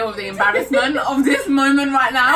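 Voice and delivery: laughing half-cry shout; embarrassed wail. Retold or imitated. [name] wailing at her embarrassment, laughing shout. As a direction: wail it in mock despair. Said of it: "I was like".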